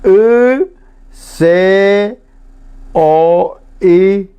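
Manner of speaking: drawn-out Guaraní letter sounds, with pauses; deletreo en guaraní. Shown as a demonstration. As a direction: spell slowly in Guaraní, starting high. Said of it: "le deletreo en guaraní". (deletreo pausado y alargado en guaraní)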